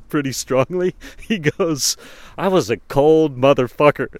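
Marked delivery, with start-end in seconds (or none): 2.4-4.1 louder, flat and blunt "cold motherfucker"; blunt confession. Retold or imitated. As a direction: state it bluntly, with no apology. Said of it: "the way he said it coming out of his voice".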